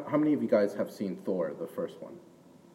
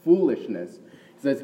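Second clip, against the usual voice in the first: normal talking voice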